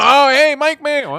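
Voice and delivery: loud, high mock-surprised greeting; mock surprise. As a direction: greet him like a sudden discovery. Host pretends to recognize masked guest.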